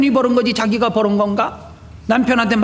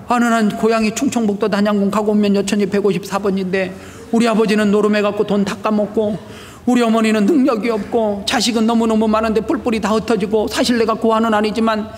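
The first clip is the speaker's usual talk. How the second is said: ordinary talk, nothing marked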